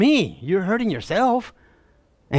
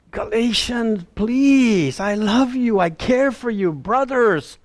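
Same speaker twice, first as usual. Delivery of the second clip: higher-pitched, heartfelt imploring; pleading; 4 semitones higher, slower than usual. Acted. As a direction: implore them as a loving pastor. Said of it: "He's pleading with them".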